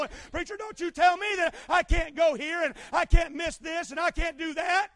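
loud, indignant, stress on "tell"; mock the resentful member pushing back; defiant churchgoer. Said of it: "I've heard it".

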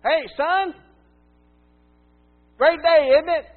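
put-on high, loud jolly old man's greeting; cheery old man. Old man cheerfully greeting the preacher, high and loud. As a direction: beam at the grumpy stranger. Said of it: "he looks at me and he says".

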